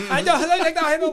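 high mock yell in a put-on Arabic accent; hammed-up background shouting. Retelling the prank call's background voice, mock yell. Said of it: "yelling in Arabic".

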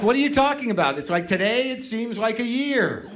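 put-on high, gushing girlfriend voice; lightly voiced smitten girlfriend; mock girlfriend. Girlfriend's reply quoted.

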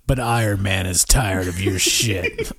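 deep dramatic trailer narrator; movie trailer voice. Pretend. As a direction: boom it out like an old trailer. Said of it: "I want the voice again".

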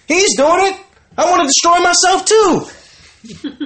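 very high, hammed-up copycat complaint; exaggerated squeal of mock indignation; mock whining protest. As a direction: wail it like a jealous imitator, mockingly. Mimicking women justifying promiscuity.

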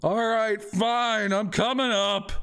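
high-voiced shopkeeper grudgingly climbing out; exasperated merchant. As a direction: sigh and give up on them. Merchant gives in.

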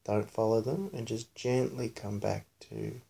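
soft, quiet meditation voice; hushed, soothing body-scan guidance; guided meditation. Real, not acted. Leads child's guided meditation.

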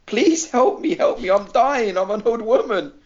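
high, drawn-out mock cry for help; shouted plea. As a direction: imitate an old woman wailing for rescue. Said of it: "She starts shouting in Greek".

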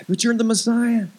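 stunned disbelief. Acted. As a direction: gasp in disbelief, then reluctantly accept. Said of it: "his jaw drops".

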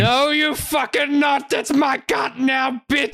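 high, slow, drawn-out angry shout; snowman's angry outburst. Acted. Snowman to players heading for his cart, angry shout. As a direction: shout it as a furious snowman staking a claim.

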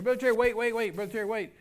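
high, loud call to someone leaving; imitated pleading shout; urgent calling out. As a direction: call after him to stop, words repeated fast. Man running after him, calling out.

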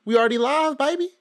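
high, loud hype-man shout; hyped show opening. Acted. Host hyping the podcast's new year, excited.

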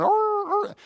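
preacher retelling the dog howling again on the call, high yelps; imitated dog howl. Imitating the neighbor's dog to the congregation, brief high yelps. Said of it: "then I'd hear it again".

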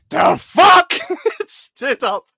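imitating a furious customer, high and loud; mock yell at the waiter, laughing through it; angry screaming customer. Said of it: "And he was screaming at Sam".

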